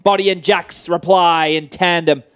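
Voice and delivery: high, loud dramatic narration; excited. Pretend. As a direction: build it into a thrilling climax. Dramatic documentary narration of action.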